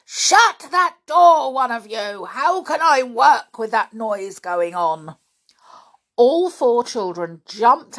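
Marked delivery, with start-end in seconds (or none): 0.1-5.2 angry complaint. A narrator's reading. Man annoyed by the noise, angry shout. Angry order to shut door.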